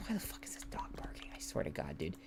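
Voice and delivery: hissed, irritated whisper; a real fed-up whisper; annoyed whisper. Real, not acted. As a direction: whisper the complaint. Annoyed at barking dog.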